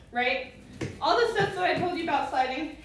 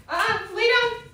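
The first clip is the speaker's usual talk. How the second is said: high dog command; 8 semitones higher, 6 dB louder than usual. Real, not acted.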